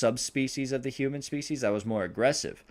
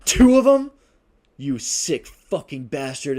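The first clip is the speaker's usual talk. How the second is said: shocked outrage; 5 semitones higher, 11 dB louder than usual. Acted. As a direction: shriek in disbelief, then spit the insult. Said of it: "She goes".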